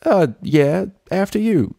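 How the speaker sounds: high, slow then squeaky country voice; nervous lying. A narrator's reading. Applejack stalling at the door, nervous and high. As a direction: fumble the cover story.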